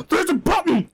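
real gasp, then high loud exclamation; squealed excitement after a gasp; excited discovery. Real, not acted. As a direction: blurt it out high and loud, thrilled. Discovers the pronunciation button.

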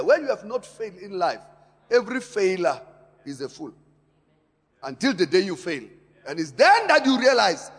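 loud, rising sermon climax; forceful preaching. Acted. Preacher hammering the congregation, loud and building. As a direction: preach it hard and loud to the church.

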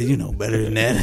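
low creepy geezer line; old man imitation. Old man chides his aide, low geezer imitation. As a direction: drop low for a mock old-man scolding. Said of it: "He said".

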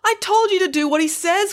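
very high exasperated cry. A narrator's reading. Manager to her disobedient teller, crying out. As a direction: scold in a panicky high pitch. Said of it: "cried the manager".

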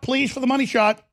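usual speaking voice

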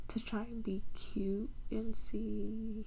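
normal talking voice

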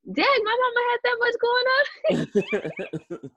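high, drawn-out imitation of an amazed kid; mock amazement. Kids discovering mom's old episodes, mock amazed. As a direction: squeal it slowly, breaking into laughter. Kids' imagined amazed reaction, acted.

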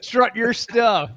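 high, slow teasing cheer. Pretend. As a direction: egg him on, drawn out. Teasing friend.

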